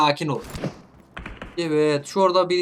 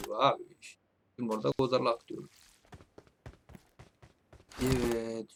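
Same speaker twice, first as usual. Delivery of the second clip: softer and fast aside; soft fast explanation; quick mumble; 5 semitones lower, 9 dB quieter than usual. Real, not acted. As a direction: toss it off quietly and quickly.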